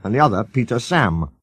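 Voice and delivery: plain reading voice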